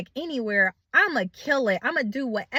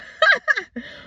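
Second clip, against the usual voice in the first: laugh; 4 dB louder than usual. Real, not acted. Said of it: "I'm laughing now".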